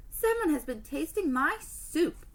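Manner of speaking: roar. Acted. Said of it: "he roared".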